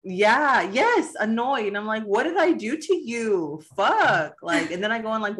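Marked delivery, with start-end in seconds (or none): 0.0-1.7 higher, insistent mock annoyance; comically irked; emphatic annoyance. Acting out annoyance at sighing. 2.0-4.4 hammed-up exasperation; retold exasperated outburst. Retold quarrel with husband.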